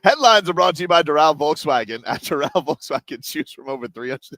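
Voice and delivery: upbeat sponsor read. Acted. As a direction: read it like a radio ad.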